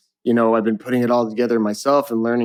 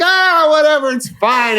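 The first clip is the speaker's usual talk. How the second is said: much higher, louder breezy brush-off; carefree dad; 4 dB louder than usual. Retold or imitated. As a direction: wave it off like an unbothered dad. Dad brushing off son's warning.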